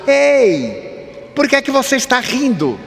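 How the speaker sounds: imitating a man calling from the third row; called out. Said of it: "gritou de lá".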